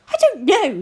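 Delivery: high, loud, flustered outburst; spluttering. A narrator's reading. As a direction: blurt it out helplessly. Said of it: "he spluttered".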